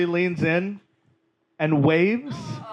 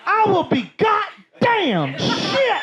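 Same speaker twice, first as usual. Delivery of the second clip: shrieking aunt; slower than usual. Retold or imitated. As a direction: scream in awe at the trash can. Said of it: "And she goes".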